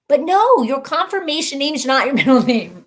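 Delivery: loud laughing correction; emphatic correction. Real, not acted. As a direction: set the record straight, laughing.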